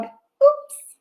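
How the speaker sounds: high surprised oops; a mock little accident; playful oops. Water spills in rhyme.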